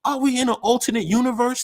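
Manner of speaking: can't believe what he's hearing; stunned disbelief. Retold or imitated. Watching a simp interview, high disbelieving cry. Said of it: "I was like".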